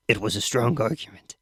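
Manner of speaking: plain reading voice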